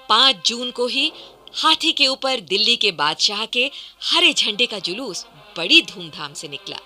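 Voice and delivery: ordinary delivery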